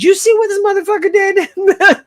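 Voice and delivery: high, laughing gossip; gossipy laugh. Retold or imitated. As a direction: laugh it out in bed. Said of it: "be like".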